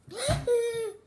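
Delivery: nervous laugh. Pretend. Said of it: "How about you scared?".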